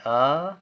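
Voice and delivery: आ held as a long aa; long vowel. Shown as a demonstration. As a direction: draw आ right out. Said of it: "आ देर तक उच्चारण हो रहा है".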